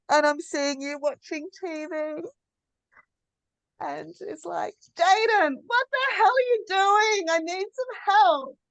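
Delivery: a frazzled mum snapping for help; exhausted new mother. Acted. So here I am.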